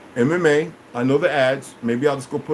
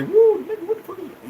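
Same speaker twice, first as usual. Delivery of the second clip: mocking imitation. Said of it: "I've heard people say".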